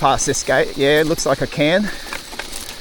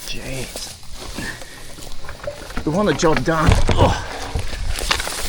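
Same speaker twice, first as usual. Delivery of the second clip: normal talking voice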